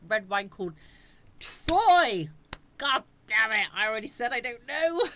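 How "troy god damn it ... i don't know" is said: loud, high hammed-up yell at a chat viewer; mock exasperated outburst. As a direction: blow up in playful frustration. Exasperated at repeated chat question.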